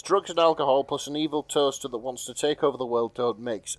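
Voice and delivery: usual speaking voice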